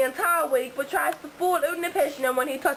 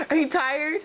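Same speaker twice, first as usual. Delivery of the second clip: normal talking voice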